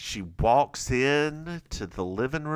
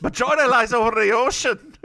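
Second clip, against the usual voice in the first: loud, high mock Scottish with laughter in it; Scottish accent retry; 7 semitones higher, 5 dB louder than usual. Host redoes the line for a critical co-host, laughing Scottish attempt. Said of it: "supposed to be Scottish".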